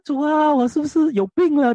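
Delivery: 音调拔高的夸张哭诉; 半开玩笑地模仿自己向主哭诉; 向主诉苦. Retold or imitated. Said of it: "我就说：". (牧师模仿当年的自己向主哭诉，语调高而夸张)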